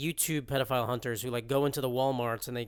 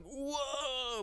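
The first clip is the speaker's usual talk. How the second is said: mock excited whoop; 12 semitones higher, 7 dB quieter than usual. Acting out multiple exclamation points, mock excited whoop. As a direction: let out a held-back, high hype wail.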